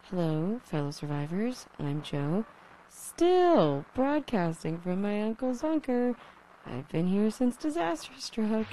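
a survivor broadcasting into static; crackly lonely radio dj. Acted. Repeated broadcast intro.

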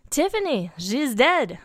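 loud, high tearful French character; distraught Frenchman breaking bad news. A narrator's reading. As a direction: cry it out loudly with a French accent. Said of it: "tears running down his face".

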